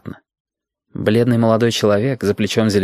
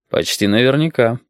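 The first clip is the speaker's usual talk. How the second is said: plain reading voice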